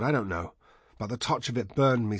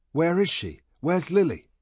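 raised, louder urgent questions; urgent questioning; 7 semitones higher, 5 dB louder than usual. A narrator's reading. Dreamer asking a stranger where Lily is, urgent. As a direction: call out urgently for someone missing. Dreamer demanding his lost wife.

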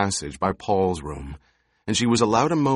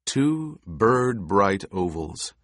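ordinary delivery, nothing marked

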